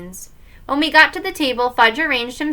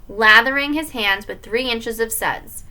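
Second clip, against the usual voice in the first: plain reading voice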